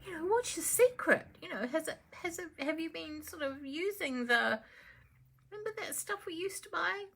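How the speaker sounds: Phyllis gushing. Acted. Phyllis gushes to the Empress.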